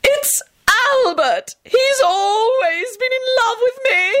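high, slow, drawn-out triumphant announcement. A narrator's reading. Grandmother proclaiming Albert's love, triumphant and high. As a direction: gloat it, stretching the words high. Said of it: "Caroline pronounced triumphantly".

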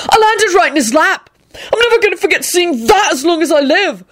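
high-pitched horrified rant; acted outraged hysteria; deranged roaring. A narrator's reading. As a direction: rant as if unhinged. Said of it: "Neville roared with a deranged look".

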